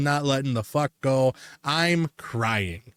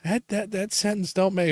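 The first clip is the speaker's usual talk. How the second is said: high, stammering mock actor voice; stuttering actor; 7 semitones higher than usual. Imitating the flustered actor who broke character, stammering. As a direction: stumble over the words like a flustered actor. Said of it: "she's sitting there going like".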